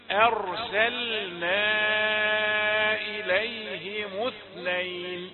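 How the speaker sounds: أرسلنا إليهم اثنين said slowly, kasra on ha kept; slow careful recitation. Shown as a demonstration. Teacher modelling the verse for students. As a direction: recite slowly and keep every vowel. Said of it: "حافظ على كسرها".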